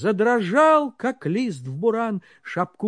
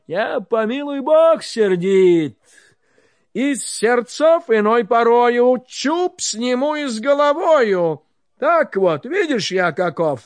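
loud boast; 3 semitones higher, 6 dB louder than usual. Acted. Tsar boasts of his temper.

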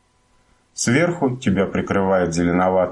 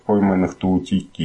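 ordinary delivery

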